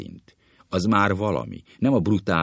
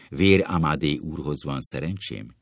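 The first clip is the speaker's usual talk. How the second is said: ordinary delivery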